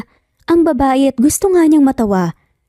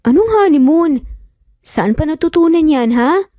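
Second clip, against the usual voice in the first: startled, higher pitch; shocked question; 6 semitones higher than usual. Acted. Athena shocked at her son's words, surprised. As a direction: catch her off guard. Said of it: "Gulat nga na tanong".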